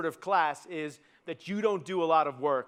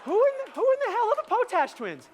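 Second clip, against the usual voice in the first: very high, loud comic screech; exasperated yell; 5 dB louder than usual. Acted. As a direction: yell it out in mock-baffled outrage. Repeats the baffled punchline, escalating.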